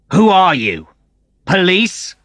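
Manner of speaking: high, loud angry demand; angry shout. Acted. Antonio points the gun at Matt and shouts, high and angry. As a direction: bark the question at him. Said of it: "Antonio shouted again".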